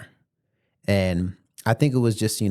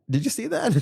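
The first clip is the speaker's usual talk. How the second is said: high, giddy imitation of the passenger; giddy high question; 14 semitones higher than usual. Driver voicing the tipsy passenger asking if he saw, giddy high squeak. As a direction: play her tipsy, squeaky disbelief. Drunk passenger excited about near-miss.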